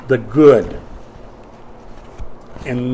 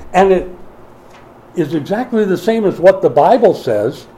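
louder, higher preacher's push; forceful preaching; 5 semitones higher, 5 dB louder than usual. Acted. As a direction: drive the point home from the pulpit.

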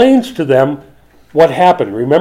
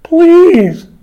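high, loud, stretched-out whine; hammed-up pleading whine; whiny begging; 9 dB louder than usual. Preacher acting out Moses begging God, whiny plea. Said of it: "can't say it in a whiny enough voice".

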